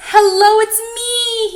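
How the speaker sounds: very high, loud happy call; joyful call. A narrator's reading. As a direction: call out overjoyed. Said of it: "he called".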